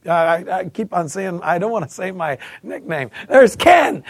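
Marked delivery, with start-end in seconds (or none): 3.3-4.0 loud, high shout across the room; calling out. Retold or imitated. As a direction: yell it out to the group. Friends call out his name.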